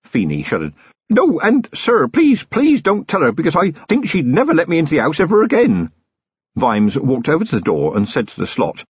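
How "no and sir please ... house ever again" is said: high, pleading and flustered; panicked young constable. A narrator's reading. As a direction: beg him in a rush not to tell. Feeney panicking about his mother.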